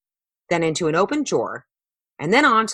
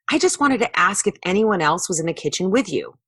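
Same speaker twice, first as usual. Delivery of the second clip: ordinary delivery, nothing marked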